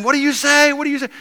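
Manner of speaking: high, loud mock yell of an angry mob; the angry mob yelling at Jesus; mob screaming. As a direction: shout the challenge up high like the mob. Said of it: "they're screaming at him".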